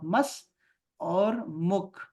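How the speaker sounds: मस, pause, then मुख very slow; split into syllables. Shown as a demonstration. Teacher splits the word for beginners. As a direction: break मसमुख into मस and मुख. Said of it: "ऐसे लिखो".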